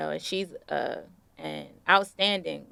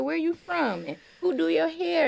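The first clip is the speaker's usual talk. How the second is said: high, bright-eyed curious questions, well above her own voice; a mild, high-pitched imitation of a welcoming local; friendly Southern lady; 12 semitones higher, 4 dB louder than usual. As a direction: play the nosy, loving auntie asking questions. Voicing a friendly Southern woman.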